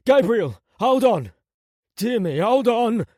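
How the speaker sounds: high, loud, desperate call; urgent shout. Acted. Companion calls to the collapsing prince, urgent shout. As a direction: shout to someone slipping away.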